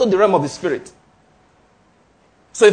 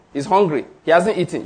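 ordinary talk

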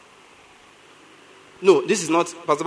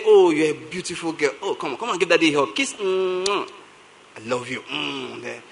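tender father; 3 semitones lower, 4 dB quieter than usual. Retold or imitated. Voicing tender morning greeting to children.

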